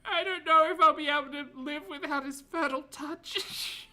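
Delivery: high wailing mock sob; hammed-up performative crying; fake crying. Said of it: "that was performative crying".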